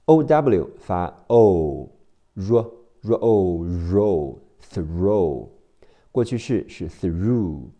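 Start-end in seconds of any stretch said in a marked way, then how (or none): none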